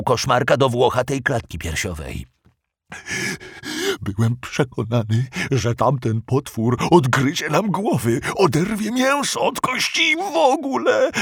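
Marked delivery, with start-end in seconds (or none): 4.0-11.1 whining monster voice, rising in fear; frightened moaning. Acted. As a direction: moan it out scared. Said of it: "wyjęczał ze strachem".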